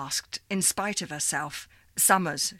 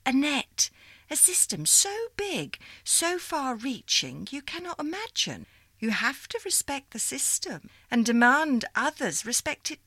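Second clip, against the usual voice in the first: put-on old operator lady; 5 semitones higher than usual. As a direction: greet her like a fond old supervisor. Miss Liza's long speech.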